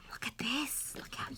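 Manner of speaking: hushed teasing whisper. Real, not acted. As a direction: tempt the dog softly. Teasing dog with food.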